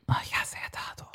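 playful hushed whisper. Pretend. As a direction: whisper it back like a conspirator. Mocking reply to the suggestion.

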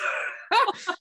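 high-pitched cackle of real laughter. Real, not acted. S1 cracking up at Petra's nod, high burst of laughter.

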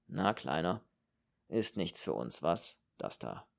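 low-pitched, gently teasing; good-natured mockery. A narrator's reading. Steiner teases young Kern about the cafés, good-natured mockery. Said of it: "mit gutmütigem Spott".

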